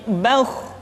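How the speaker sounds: "bauh" with rounded, heavy vowel before kh; thick kha. Shown as a demonstration. Teacher giving the correct form, thick sound. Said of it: "suara yang tebal".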